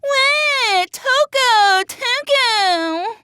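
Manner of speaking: high, wailing imitation of a crybaby; mock crying plea. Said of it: "would have been sobbing and crying out".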